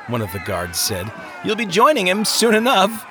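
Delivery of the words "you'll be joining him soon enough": high, mocking guard voice; high-pitched jeer; taunting guard. A narrator's reading. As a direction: gloat at the prisoner in a raised pitch. Guard taunting weeping prisoner.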